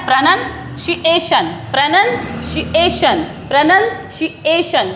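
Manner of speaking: "प्रोनाउंसिएशन" as pruh-nun... shee-AY-shun, very slow; slow repeated "प्रोनाउंसिएशन" with a pause before shee; correct pronunciation drill. Shown as a demonstration. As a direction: split "प्रोनाउंसिएशन" after nun, lean on shee-AY. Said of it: "शी पर जो है यहाँ stress".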